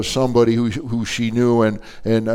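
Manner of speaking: normal talking voice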